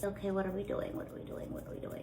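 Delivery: soft, near-whispered self-talk; muttering to herself. Real, not acted. Cook asks herself what comes next, muttering. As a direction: repeat it under your breath, fading away. Talking to herself, lost her place.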